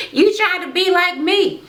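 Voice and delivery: very high-pitched sassy retort; mock accusation. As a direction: throw it back in a squeaky mock quarrel. Acting out reality-show quarrel.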